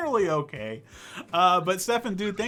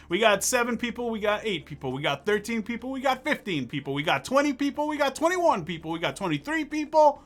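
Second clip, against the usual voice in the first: hype count-up; 5 semitones higher than usual. Real, not acted. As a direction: pitch climbing high with each count. Hyping up the raid headcount.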